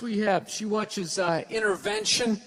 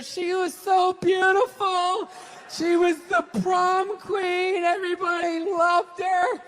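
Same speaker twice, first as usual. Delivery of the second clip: mock sentimental recap; 8 semitones higher, 4 dB louder than usual.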